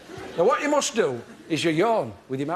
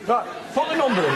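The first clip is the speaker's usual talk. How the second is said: demo voice; 5 semitones higher, 3 dB louder than usual. Shown as a demonstration. Demonstrating phone call technique.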